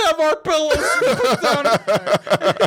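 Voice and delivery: slow hammy motivational speaker; Matt Foley. Retold or imitated. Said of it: "he sounds like Matt Foley".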